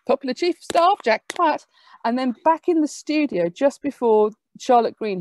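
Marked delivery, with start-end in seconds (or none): 0.1-1.7 higher, louder, bold newsreader delivery; mock announcer boldly declaiming the name; going for it. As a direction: announce it proudly, no hesitation. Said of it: "he just goes for it".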